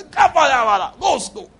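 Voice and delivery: high shouted call to slow down. Retold or imitated. Preacher mock-crying his own prayer aloud. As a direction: cry out the name, then ease off.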